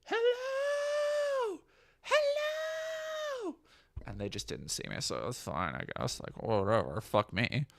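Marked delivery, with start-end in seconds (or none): 0.0-3.5 calling from afar. Retold or imitated. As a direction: shout hello across a distance. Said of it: "from afar was like".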